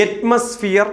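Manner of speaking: അറ്റ്മോസ്ഫിയർ opening on "aet", weak "mus"; correct pronunciation. Shown as a demonstration. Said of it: "എന്നാണ്".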